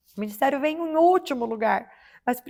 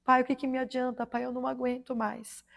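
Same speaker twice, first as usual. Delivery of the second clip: tearful prayer; 7 dB quieter than usual. Retold or imitated. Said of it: "mas eu ia chorando".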